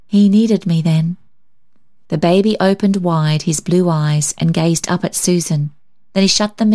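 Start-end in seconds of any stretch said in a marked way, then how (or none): none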